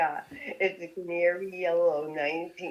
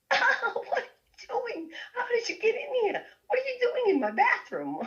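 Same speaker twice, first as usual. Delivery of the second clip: high laughing imitation of a surprised rock star. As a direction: squeal it like a startled man caught off guard. Frank startled, voiced high.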